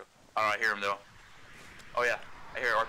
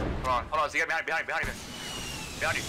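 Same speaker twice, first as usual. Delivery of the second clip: panicking gamer; 4 semitones higher, faster than usual. Real, not acted. Teammate flanked, urgent callout.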